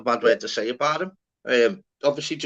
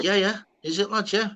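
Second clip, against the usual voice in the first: Scouse lad voice; 8 semitones higher than usual. Retold or imitated. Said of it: "Um, like".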